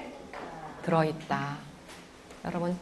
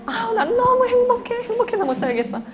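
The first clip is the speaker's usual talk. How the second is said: overflow with delight, laughing; a mother gushing with happiness; giddy happiness; 3 dB louder than usual. Shown as a demonstration. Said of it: "빨리 말아요".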